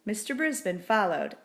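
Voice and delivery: ordinary delivery, nothing marked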